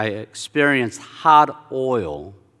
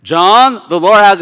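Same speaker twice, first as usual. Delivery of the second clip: much higher and louder, impatient urging; exasperated repeat; 8 semitones higher, 13 dB louder than usual. Retold or imitated. Minister repeating himself to a stuck student, exasperated. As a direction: call out the name in mounting irritation. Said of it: "he gets a little irritated".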